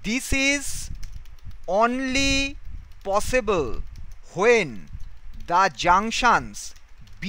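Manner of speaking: word by word, long pauses between; slow dictation. Shown as a demonstration. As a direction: dictate each word slowly to class. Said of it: "তাহলে আমি কি বলবো?".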